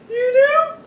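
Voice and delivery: high, astonished question; mock surprise. Dad pretending to be amazed at the kids' answer, high.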